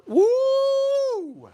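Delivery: high happy woo; excited whoop. Real, not acted. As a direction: whoop with delight. Excited about the food.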